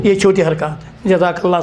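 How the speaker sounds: ordinary talk, nothing marked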